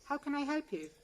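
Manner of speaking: Southern English receptionist reading a practised greeting; Southern English receptionist accent. Acted. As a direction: answer the office phone like a polished Southern English receptionist. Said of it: "A Southern English accent, placeless".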